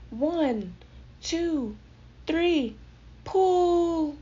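high yelled count, building to a long pull. A narrator's reading. Timothy leading the rescue pull, yelling the count. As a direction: count off, then holler the last word. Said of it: "Timothy yelled".

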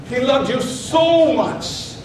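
"so" long, slow and emphatic; stress on SO. Shown as a demonstration. Preacher assuring congregation of God's love, stress on SO. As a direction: draw out "so" with force. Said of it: "how much I can emphasize".

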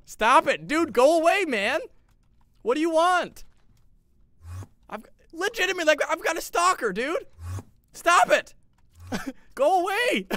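high, loud pleading shouts; frantic yelling. Real, not acted. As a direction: yell at the stalker to leave. Enderman keeps stalking him.

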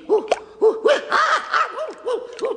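shaky yelps of alarm; wobbly alarm. Acted. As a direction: yelp as the boat wobbles. Wobbling in the bathtub boat.

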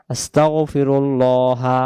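আস্তাগফিরুল্লাহা slow, with a thick heavy ল in the middle; heavy lam. Shown as a demonstration. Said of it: "লামটাকে ল এমন হবে, মোটা হবে". (আস্তাগফিরুল্লাহা ধীরে, মোটা লাম দিয়ে)

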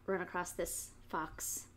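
plain reading voice

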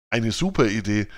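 ordinary delivery

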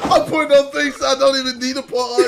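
loud, fast, laughing; loud laughter-filled rant; shouting through laughter. Real, not acted. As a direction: howl it out while cracking up. Laughing at relatable clip.

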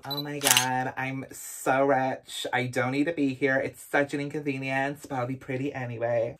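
languid, low-volume socialite impression; Paris. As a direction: play a spoilt heiress, quiet and drawling. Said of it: "Paris is literally giving you".

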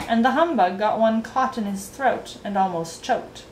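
ordinary delivery, nothing marked

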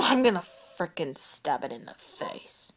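soft whispered threat; seething mutter. Real, not acted. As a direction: grumble it under your breath, whispering.

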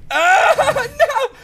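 long, very high, loud wail; panicked scream. Real, not acted. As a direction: scream in alarm as disaster hits. Screams at near death in game.

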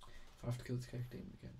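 whispered grumble. Real, not acted. Weary muttered complaint.

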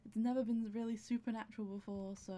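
usual speaking voice

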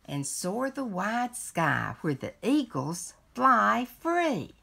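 lively picture-book read-aloud; reading to children. Acted. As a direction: read it like a bedtime story to a grandchild. Resumes read-aloud after aside.

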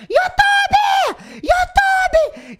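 high, loud yelling of YouTube; imitated shopping crowd shouting. Fan in a mall yells at Gabi Fadel, shrieking. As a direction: yell it across the mall like a fan. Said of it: "começaram a gritar ela assim".

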